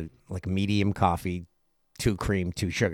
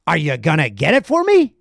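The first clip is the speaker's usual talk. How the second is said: loud, higher and pushy; rude customer; 5 semitones higher, 7 dB louder than usual. Retold or imitated. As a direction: bark it at the cashier. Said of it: "he's like".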